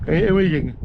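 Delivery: talking through a yawn; words swallowed by a big yawn. Real, not acted. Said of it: "sorry, I'm yawning".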